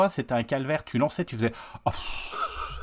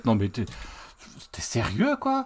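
normal talking voice

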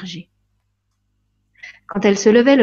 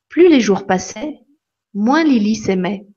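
plain reading voice

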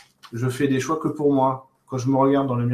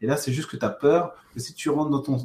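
normal talking voice

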